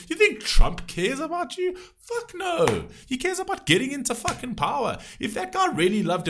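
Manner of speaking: high-pitched, outraged rant; heated rant. Real, not acted. Ranting to viewers about uncaring politicians, outraged. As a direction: rant high in angry disbelief.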